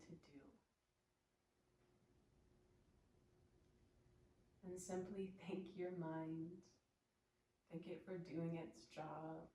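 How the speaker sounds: soft meditation voice with long pauses; guided meditation. Real, not acted. Yoga teacher guiding students to observe their breath, soft meditative voice. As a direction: gently settle them into stillness, leaving space between phrases.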